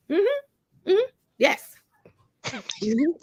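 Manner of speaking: normal talking voice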